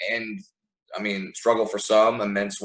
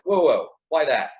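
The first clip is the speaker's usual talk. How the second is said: pulling someone up short, higher pitch; mock double-take, calling a halt; acted alarm; 5 semitones higher, 4 dB louder than usual. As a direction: stop them with a mild "hold on". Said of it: "stop and be like".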